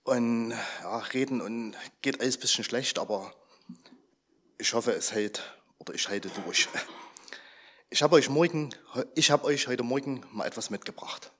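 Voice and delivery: ordinary delivery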